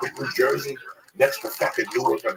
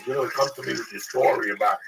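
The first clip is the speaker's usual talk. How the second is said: ordinary talk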